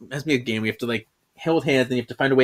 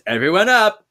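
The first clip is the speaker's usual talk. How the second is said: mock teacher rally; 6 semitones higher, 5 dB louder than usual. Teacher rallying students in detention.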